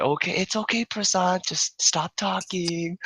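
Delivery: very high-pitched mock reassurance; imitated soothing girl. Girl hushes crying drunk man, high mimicked soothing voice.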